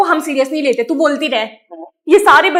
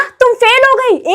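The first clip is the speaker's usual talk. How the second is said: shocked mother; 7 semitones higher, 4 dB louder, slower than usual. Acted. Mother hears daughter failed.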